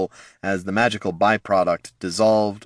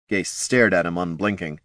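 ordinary delivery, nothing marked

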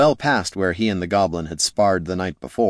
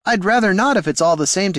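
higher, nervous voice; nervous wizard; 10 semitones higher, faster than usual. Acted. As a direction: talk like a nervous scholar.

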